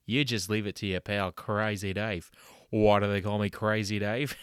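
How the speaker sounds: loud, brash Aussie ad-man voice; hammed-up Aussie huckster selling dental work; fake ad pitchman. Host doing a spoof sponsor ad, loud Aussie pitchman. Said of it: "a word from our sponsors".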